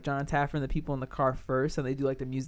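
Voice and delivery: ordinary talk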